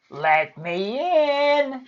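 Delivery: high, slow, drawn-out plea; old crone begging to be let in; pleading old woman. A narrator's reading. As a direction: wail it at the castle gate. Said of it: "she pleaded".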